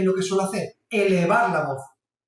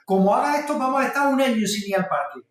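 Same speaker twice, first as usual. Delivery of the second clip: exasperated threat; 5 semitones higher than usual. Retold or imitated. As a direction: snap out an impossible threat at the kid. Padre harto lanza amenaza. (amenaza exasperada de padre harto, más aguda y fuerte)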